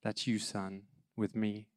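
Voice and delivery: low, very soft, measured words; hushed divine voice. Retold or imitated. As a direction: imitate a still, small voice in the dark. God's voice heard in the darkness.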